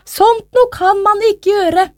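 high, slow disapproval; appalled mother. A narrator's reading. Mother condemns the king's behaviour, high appalled voice. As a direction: draw it out high in disbelief.

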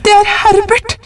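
very high, frantic mother's cry; shriek. A narrator's reading. Wife is sure it is her son, very high shriek. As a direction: shriek it out, rising at the end. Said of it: "skrek hun".

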